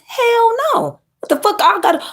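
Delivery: "Hell no" squeaked way up high; incredulous hell no. Real, not acted. Asked if it stopped her, shrieking hell no. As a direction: shriek the denial in disbelief. Emphatic denial.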